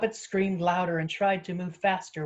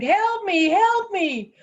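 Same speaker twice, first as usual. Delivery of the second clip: very high, loud panicked wail; acted scream of panic; screaming for help; 12 semitones higher, 7 dB louder, slower than usual. A narrator's reading. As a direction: cry out in terror, voice way up. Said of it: "Poppet screamed".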